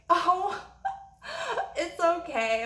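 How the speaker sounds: high, giggling little "oh, it's okay"; mock giggly waitress. Imitating the waitress giggling it off to the angry customer. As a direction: play the nervous giggling waitress.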